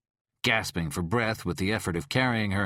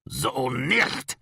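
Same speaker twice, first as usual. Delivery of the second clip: mild angry growl; 4 semitones higher than usual. A narrator's reading. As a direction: grind it out madly. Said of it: "he growled madly".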